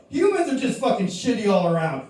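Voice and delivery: normal talking voice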